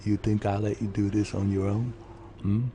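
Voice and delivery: low, gruff Joel impression. To Ellie, in a low Joel voice. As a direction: growl it like a weary father figure. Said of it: "I told Ellie".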